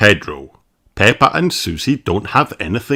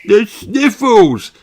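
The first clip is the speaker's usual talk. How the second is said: sneezing high pony voice; sick little pony, sneezing; sniffly pony voice; 11 semitones higher, 3 dB louder, slower than usual. Acted. Pedro voiced.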